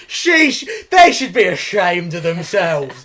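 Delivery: high, loud, slow hammed-up indignation; mock outrage. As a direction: rail like a scandalised auntie. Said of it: "was like".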